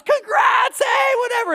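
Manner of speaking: high, loud mock cheer of a jumping-out crowd; crowd cheering shout. Retelling the surprise crowd at the proposal, loud cheering shout. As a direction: shout it like the hidden family popping out.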